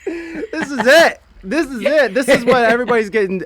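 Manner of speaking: high, loud, laughing hype; a gleeful high-pitched pitch; excited hype. Real, not acted. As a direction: sell it like the next big trend. Hyping the joke.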